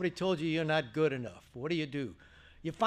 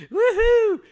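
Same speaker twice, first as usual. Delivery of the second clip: high, loud whoop of joy; gleeful whoop; 15 dB louder than usual. Retold or imitated. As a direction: cheer like you just won. Sports director quit, his chance.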